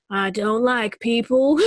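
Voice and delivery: cranky hermit witch with cackles; old mountain witch. Pretend. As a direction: shoo them off her land. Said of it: "the old witch of the mountains".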